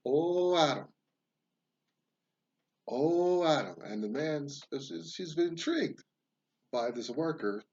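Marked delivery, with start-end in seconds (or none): 0.1-3.8 loud, high groaning cry with each swing; labor cry. Acted. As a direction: call out Adam as the axe lands. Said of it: "every time he swings the axe, he says".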